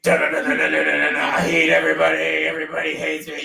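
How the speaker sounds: mock self-pitying chant; person beside you. Imitating the miserable person beside you, droning complaint. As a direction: run it together as a droning gripe. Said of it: "the person beside you is like".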